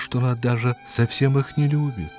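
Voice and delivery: ordinary delivery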